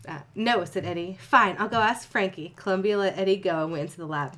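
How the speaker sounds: story reading. Acted. Reads fanfiction aloud to cohost.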